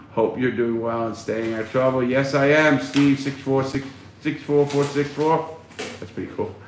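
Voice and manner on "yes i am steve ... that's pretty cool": normal talking voice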